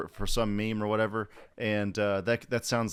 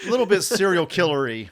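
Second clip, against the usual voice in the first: louder, higher, creeped-out joke; half-joking shudder in the voice; mock creeped out; 8 semitones higher, 9 dB louder than usual. Real, not acted. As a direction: play up the creepiness with a grin. Joking about the creepy doctor.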